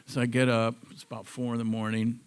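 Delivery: usual speaking voice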